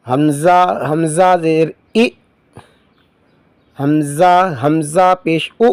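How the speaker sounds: slow, sing-song spelling chant; Qaida recitation drill. Shown as a demonstration. Teacher drilling pupils through Qaida spelling, slow sing-song chant. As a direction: call out each syllable slowly for pupils to repeat.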